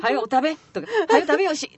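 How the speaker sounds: loud Kyoto shop auntie hurrying her customers; gruff shop auntie. Retold or imitated. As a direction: bark at the students to eat up and go. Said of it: "とか言うてね".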